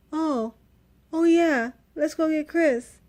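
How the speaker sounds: imitating staff who just remembered, a bit slow; mock sudden remembering. Hospital staff remembering they forgot her husband, mock surprised.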